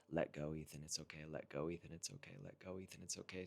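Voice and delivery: near-whispered self-soothing, repeated gently; calm yourself under your breath; soft mantra. Retold or imitated. Retelling the mantra he told himself, hushed. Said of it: "the mantra that I was telling myself".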